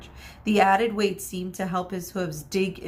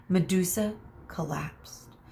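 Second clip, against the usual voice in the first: plain reading voice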